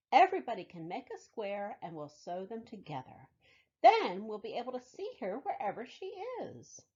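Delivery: lively storybook read-aloud; reading to children. Acted. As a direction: tell it warmly to a room of kids. Reads picture book to children.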